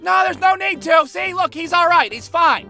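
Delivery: high, loud frantic reassurance; panicked kid. Retold or imitated. Boy shouting to stop the driver calling police, high and loud. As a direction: shout fast to stop him calling cops.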